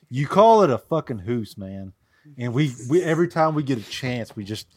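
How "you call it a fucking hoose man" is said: teasing shout. Retold or imitated. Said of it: "I'm like".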